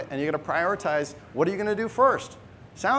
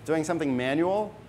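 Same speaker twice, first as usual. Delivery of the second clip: ordinary talk, nothing marked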